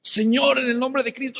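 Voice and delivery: straining cry. Retold or imitated. Final heave to free arm.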